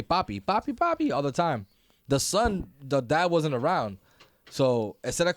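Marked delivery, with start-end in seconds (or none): 0.0-1.1 put-on child's call rising very high on the last Papi. A little daughter calling her dad, childlike and rising. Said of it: "would say".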